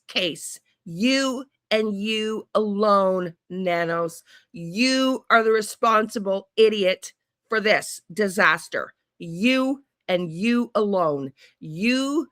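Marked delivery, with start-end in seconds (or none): 0.8-4.2 "You and you alone" very slow, both "you" stressed; slowly pin the blame on him with each "you"; slow accusation. Real, not acted. Blaming the sheriff directly. 9.2-11.3 "You and you alone" very slow, both "you" stressed; slowly pin the blame on him with each "you"; slow accusation. Real, not acted. Repeating the accusation.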